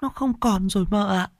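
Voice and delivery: high-voiced, tearful apology; sobbing maid. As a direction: plead and sob at once. Maid tells the bad news.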